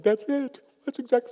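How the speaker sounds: mock breaking down, finally understood; tearful relief. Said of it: "She broke down in tears. And was like".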